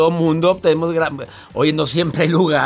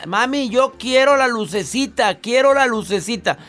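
lightly voiced whiny child; niño pidiendo; 8 semitones higher than usual. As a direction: ask like a kid begging. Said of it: "el niño y la". (niño suplicando, voz aguda)

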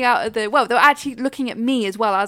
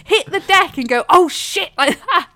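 louder, disbelieving question; exasperated retelling to mum, raised and slowed; mock-indignant protest; incredulous; 9 semitones higher, 7 dB louder than usual. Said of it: "I'm like".

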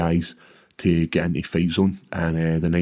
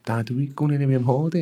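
daughter asking; 7 semitones higher, faster than usual. Retold or imitated. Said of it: "she's like".

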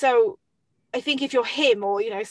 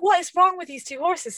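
higher, louder exasperated cry; exasperated outburst; 9 semitones higher, 6 dB louder than usual. Retold or imitated. Rider retelling falling off, higher, louder exasperated cry. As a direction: throw up your hands at the horses. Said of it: "And I was like".

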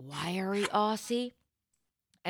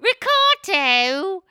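very slow, high, loud Australian "Ricciardo"; Australian accent; 12 semitones higher, 10 dB louder, much slower than usual. Acted. Saying "Ricciardo" in an Australian accent to impress him. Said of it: "my Aussie accent".

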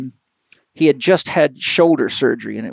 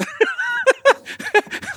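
high laugh. Real, not acted. Laughs at own retort.

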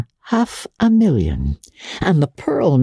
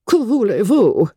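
put-on a quick, higher French "Que voulez-vous?" in a French accent; French shrug; 7 semitones higher than usual. A narrator's reading. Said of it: "one of her queer foreign jerks".

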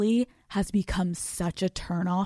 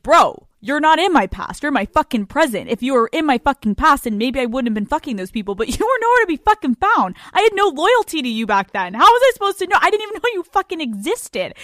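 louder and higher, firing up into a laughing rant; heated rant; 5 semitones higher, 6 dB louder than usual. Real, not acted. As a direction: rant at him, climbing higher with each line. Heated rant at jealous boyfriends.

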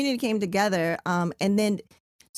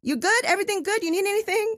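much higher, bright caring check-in; concerned check-in; 12 semitones higher than usual. Retold or imitated. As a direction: ask a stranger kindly if they're okay, pitched up high. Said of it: "I was just like".

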